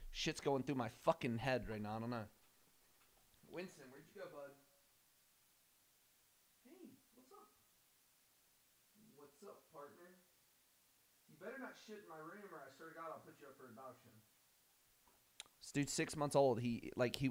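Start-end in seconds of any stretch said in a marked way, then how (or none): 3.5-14.1 talking to puppy. Real, not acted.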